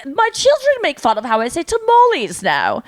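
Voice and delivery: a hint of Queen's English; posh British accent. Mother complaining about her kids, light posh British. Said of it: "the Queen's British".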